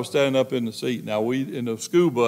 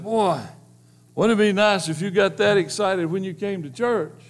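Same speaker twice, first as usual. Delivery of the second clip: teasing; 7 semitones higher than usual. Retold or imitated. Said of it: "preacher thought he'd be real cute".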